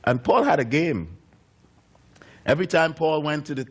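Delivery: ordinary talk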